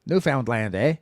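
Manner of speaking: put-on light Canadian accent, eh on the end. Repeating co-host's Canadian example, mild Canadian accent. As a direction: mimic the Canadian way. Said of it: "Say it like a Canadian".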